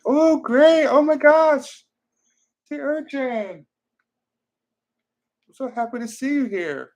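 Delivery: high, excited squeal, loud at first; real delighted surprise. Real, not acted. Streamer spots a favorite poet in the live audience, thrilled. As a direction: burst out gleefully, voice jumping way up. Delighted to spot familiar viewer arriving.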